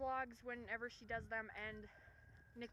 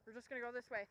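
usual speaking voice

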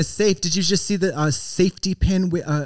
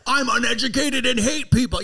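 mock American; 8 semitones higher than usual. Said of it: "Yeah, yeah".

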